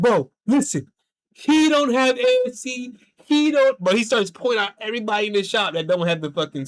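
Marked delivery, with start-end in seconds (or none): 0.0-3.8 high, loud, worked-up insistence; excited telling. Retold or imitated. Coworker pointing out uncertified techs, high loud insistence. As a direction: lean in and spill the gossip.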